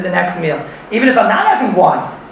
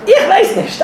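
laughing; 3 dB louder, slower than usual. Real, not acted. Laughing at unknown word grimsel.

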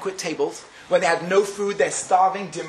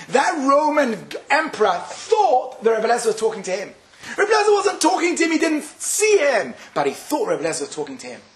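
normal talking voice

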